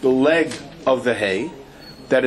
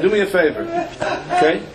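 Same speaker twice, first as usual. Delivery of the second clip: higher, laughing do me a favor; laughing plea; 7 semitones higher, faster than usual. Real, not acted. Speaker laughing, begging the man to just say hello. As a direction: plead while laughing.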